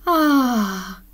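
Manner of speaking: long, falling "ahh"; relieved sigh. Acted. Alan relieving himself at last, relieved sigh. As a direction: let out a drawn-out sigh of relief.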